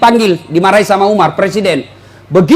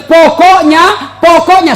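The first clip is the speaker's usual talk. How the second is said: mock nagging wife; 5 semitones higher, 3 dB louder, much slower than usual. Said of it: "Apalagi kalau dia bilang".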